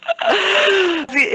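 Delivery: a sudden shriek of laughter. Real, not acted. Laughing after friend's remark.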